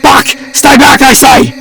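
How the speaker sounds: high, drawn-out warning yell. A narrator's reading. Philip aims at the demon, high warning yell. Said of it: "Philip yelled".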